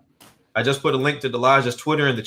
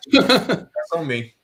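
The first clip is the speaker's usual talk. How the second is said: laughing; 5 semitones higher, much slower than usual. Real, not acted. Host laughs at own mistake.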